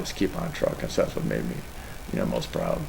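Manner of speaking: real tears, voice dropping low and soft; fighting back tears; choked up. Real, not acted. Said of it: "Sorry about that".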